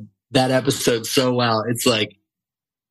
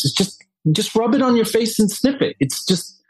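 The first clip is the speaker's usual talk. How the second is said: much higher, impatient; mock exasperated; 11 semitones higher than usual. Casting woman tells the germaphobe to just sniff the sweater, in mock exasperation. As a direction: brush him off, exasperated and pitched up.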